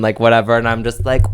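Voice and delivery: usual speaking voice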